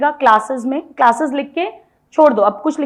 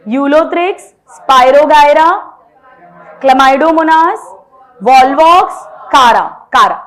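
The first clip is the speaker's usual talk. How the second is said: names called out much louder, one by one; 6 dB louder, much slower than usual. Shown as a demonstration. Teacher wants names said loudly at home, calling them out. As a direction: shout each name so the class shouts back. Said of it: "नाम बोलो".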